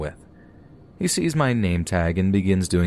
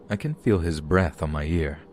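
ordinary delivery, nothing marked

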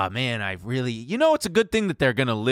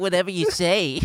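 nerdy voice; 6 semitones higher than usual. Retold or imitated. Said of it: "like, little nerdy".

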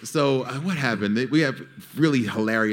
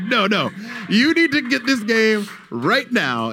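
much higher, slow, drawn-out pleading; high, slow mock urgency in a kid's voice; insistent kid voice; 11 semitones higher, 3 dB louder than usual. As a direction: lean in hard like an eager kid.

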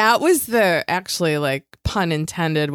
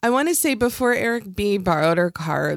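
ordinary talk, nothing marked

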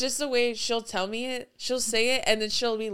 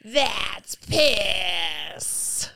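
a hammed-up crying yell, a bit louder; crying dog shout; 3 dB louder than usual. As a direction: wail it like the ugly dog in the video. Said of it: "And it's just going".

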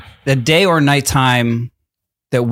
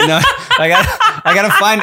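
laughing; much faster than usual. Real, not acted. Laughing during harmony practice.

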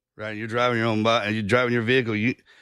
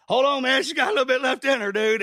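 a hammed-up, much higher voice; mock pep talk; 12 semitones higher than usual. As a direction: egg yourself on like a rowdy buddy, pitched way up. Mock inner voice egging on.

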